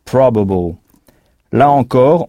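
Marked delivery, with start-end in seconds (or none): 0.0-0.8 "Probable." with the PROB syllable hit; teacher echoes English stress. Shown as a demonstration. French teacher models the English word. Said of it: "on insiste en anglais sur la syllabe qui précède".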